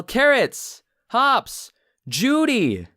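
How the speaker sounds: calling out high and loud; yelling. Retold or imitated. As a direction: shout the names in alarm. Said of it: "you see him yell".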